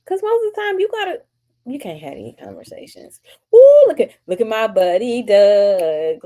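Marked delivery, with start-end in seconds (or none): 3.5-6.2 light up reading a friend's name; thrilled shout-out to a friend; excited. Real, not acted. Excited at a chat comment.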